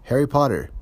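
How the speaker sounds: high, loud call from above. A narrator's reading. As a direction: call the name out across the room. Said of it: "Professor Trelawney's voice called".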